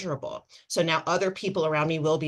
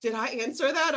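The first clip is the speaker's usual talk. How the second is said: higher, amazed little question; surprised client; 8 semitones higher than usual. Retold or imitated. Imitating the client surprised at her own answer, higher. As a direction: imitate her startled realization. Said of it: "opened her eyes as if she heard her own voice".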